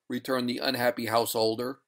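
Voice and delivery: usual speaking voice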